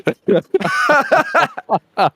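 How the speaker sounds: squealing high laugh. Real, not acted. Laughing at chat comment.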